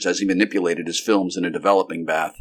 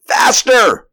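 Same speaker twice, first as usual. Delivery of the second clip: high, loud urgent yell; shouted spur to speed; cry; 8 dB louder than usual. A narrator's reading. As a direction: cry out to urge the driver on. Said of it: "cried the doctor".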